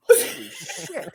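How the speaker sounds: very high, stretched-out exclamation; retold astonishment. Retelling being introduced to Tiny, high mock-astonished cry. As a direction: squeak it out in mock shock, half laughing. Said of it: "and I went".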